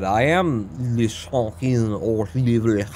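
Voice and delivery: slow, hammed-up fake French accent; mock French accent. Boasting to chat in a bad fake French accent. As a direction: butcher a French accent on purpose, slowly. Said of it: "that accent was that just butchered that".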